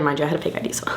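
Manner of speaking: a secret slipped to the camera, hushed; whispered aside. Real, not acted. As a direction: whisper the confession under your breath. Confessing an aside to viewers.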